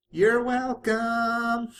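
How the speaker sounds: high, loud, very slow call across a distance; yelled from afar. A narrator's reading. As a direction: shout it back from far away. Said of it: "Pinkie had yelled out to her from her dis".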